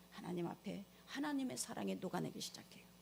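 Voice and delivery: ordinary talk, nothing marked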